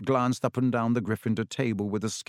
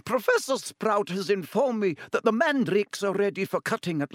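higher, crisp announcement to the whole school; stern headmistress; 9 semitones higher than usual. Acted. Professor McGonagall announcing to the students, formal and higher. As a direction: address the hall like a strict Scottish professor. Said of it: "Professor McGonagall said".

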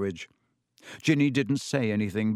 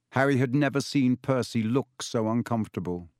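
ordinary delivery, nothing marked

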